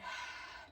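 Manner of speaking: long, airy exhaled sigh; demonstrated sigh. Shown as a demonstration. Yoga teacher showing students the exhale, releasing sigh. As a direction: let the breath out through the mouth. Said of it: "Exhaling through the mouth with a sigh".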